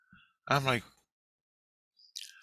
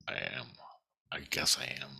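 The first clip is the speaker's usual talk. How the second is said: whisper; 6 semitones lower, 10 dB quieter, faster than usual. Real, not acted. Muttered self-admission.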